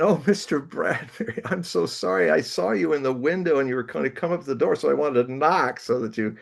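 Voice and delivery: put-on high, flustered young writer apologizing; a jittery fan pleading his case at the door; nervous apology. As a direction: blurt it out, anxious to explain. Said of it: "and I went".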